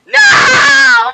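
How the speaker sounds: sudden high shout of horror; shocked shout. Real, not acted. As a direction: cry out in disbelief. Sim reaper kills SpongeBob.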